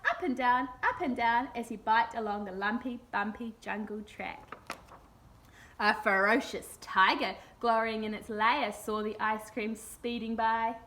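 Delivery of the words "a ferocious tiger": usual speaking voice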